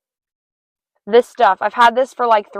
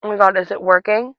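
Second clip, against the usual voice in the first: ordinary talk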